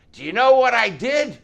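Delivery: high, loud fanatical shout; wild, yelled challenge; crazy madman. Acted. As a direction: demand it like a raving madman. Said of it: "he sounded like a fanatic, crazy madman".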